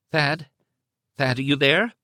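frightened wife reaching for her husband; harried and afraid. Acted. Wife on the phone under the kidnapper's eye, harried and afraid. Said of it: "She sounded harried and afraid".

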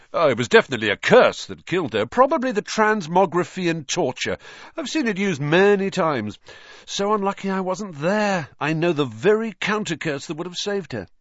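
high, quick, lively self-important voice; boastful know-it-all. A narrator's reading. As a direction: show off as the expert, pitched well up. Narrator voices boastful Lockhart.

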